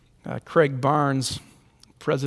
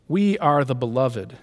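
ordinary delivery

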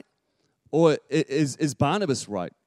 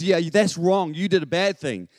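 loud, higher scolding; stern truth; 4 semitones higher, 4 dB louder than usual. Acted. Truth tells the boy he did wrong, scolding. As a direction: lay down the blunt verdict. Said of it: "Truth says".

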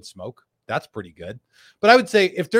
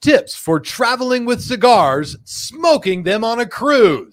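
higher, louder and slow, like it's written in caps; all-caps yell; 8 semitones higher, 7 dB louder, slower than usual. Shown as a demonstration. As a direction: belt it out slowly, all caps. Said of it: "You gotta say it in your Tim voice".

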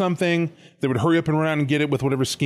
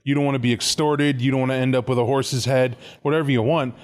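usual speaking voice

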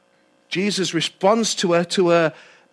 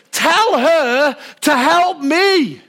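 higher, louder and slow, exasperated; frustrated demand; 5 semitones higher, 3 dB louder, much slower than usual. Acted. Martha pointing at Mary, demanding Jesus act, frustrated. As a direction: drag out each word like a worn-out sister. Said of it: "Can you hear the frustration?".

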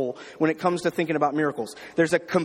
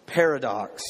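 ordinary talk, nothing marked

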